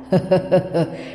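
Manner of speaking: put-on a creepy old ghost chuckling; ghostly cackle. Ghost laughs again.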